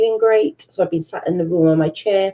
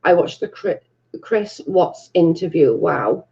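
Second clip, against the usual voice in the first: ordinary talk, nothing marked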